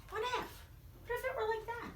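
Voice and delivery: high, soft wondering voice; hushed dreamy what if. Acted. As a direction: float the question up.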